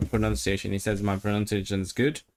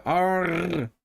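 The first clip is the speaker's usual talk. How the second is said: higher, rolled Cornish-style "arr"; Cornish pirate arr; 7 semitones higher than usual. Shown as a demonstration. Guessing how Cornwall pirates said it, trilled arr. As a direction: roll a West Country arr. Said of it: "if pirates came from Cornwall".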